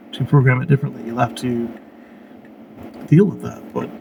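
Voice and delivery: normal talking voice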